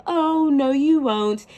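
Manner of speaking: a high, pitying little coo; pitiful chirpy mother. Retold or imitated. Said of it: "pitiful little mother chirpy sound".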